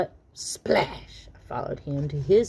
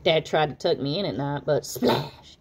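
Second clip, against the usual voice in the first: ordinary delivery, nothing marked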